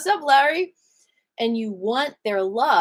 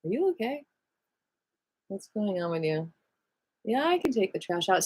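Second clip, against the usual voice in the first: reassuring; 3 semitones lower, 6 dB quieter than usual. Acted. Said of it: "be like".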